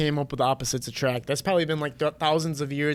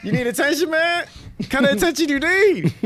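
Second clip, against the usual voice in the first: mock roast; 7 semitones higher, 3 dB louder than usual. Roasting his own double.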